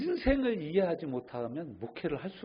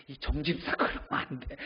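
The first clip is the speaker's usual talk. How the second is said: stern scolding. Retold or imitated. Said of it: "그럼 우리는 대부분 뭐라고 합니까".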